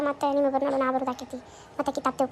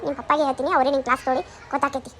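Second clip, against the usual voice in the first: normal talking voice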